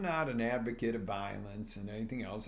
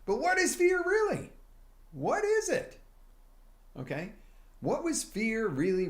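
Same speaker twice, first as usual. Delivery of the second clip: high, loud theatrical voice asking the big question; dramatic question; 12 semitones higher, 5 dB louder than usual. Acted. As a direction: pitch it way up like a spooky teaser. Dramatic rhetorical question to audience.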